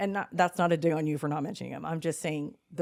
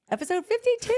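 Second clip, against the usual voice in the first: high, loud announcer-style reveal; teasing announcement; excited reveal; 6 dB louder, faster than usual. Real, not acted. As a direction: build it up as a big reveal. Announcing the episode as a tease.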